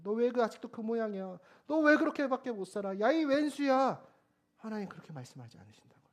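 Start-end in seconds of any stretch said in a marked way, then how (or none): 0.0-4.0 exasperated reproach, rising in pitch; mock scolding. Imagined God berating a failing believer, mock scolding. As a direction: build a nagging rebuke, drawing out the last insult. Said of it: "이렇게 책망하지 않으신다고요".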